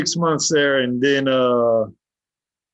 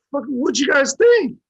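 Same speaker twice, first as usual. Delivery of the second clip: much higher-pitched incredulous question; retold incredulous retort; 13 semitones higher than usual. Inmate answers the admiring yard, incredulous. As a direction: squeal it in mock disbelief.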